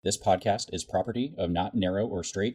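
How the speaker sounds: calm outro announcer read. Acted. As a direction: read the credits evenly. Closing credits read.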